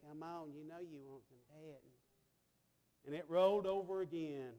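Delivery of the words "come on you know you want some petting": very soft, low call to a cat; hushed kitty-calling; soft coaxing. Retold or imitated. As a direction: gently coax a shy cat over.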